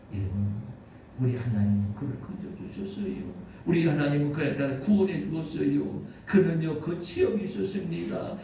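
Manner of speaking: normal talking voice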